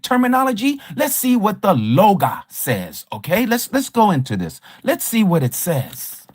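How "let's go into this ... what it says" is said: ordinary delivery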